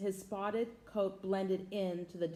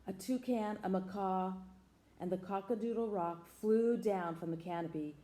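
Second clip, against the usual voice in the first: usual speaking voice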